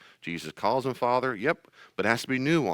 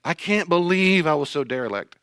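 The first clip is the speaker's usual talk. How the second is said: mock anguish; 6 semitones higher, 5 dB louder than usual. [name]'s over-the-top guilt.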